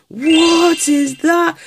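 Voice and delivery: high, slow amazed question; wide-eyed disbelief at the card; amazed gasp. Real, not acted. Pulling a strange card, amazed.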